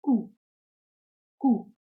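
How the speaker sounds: "Ku" said as German Q, koo; German letter name. Shown as a demonstration. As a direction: say "Ku" as a clear, isolated koo. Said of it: "is pronounced as".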